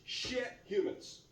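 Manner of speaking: ordinary talk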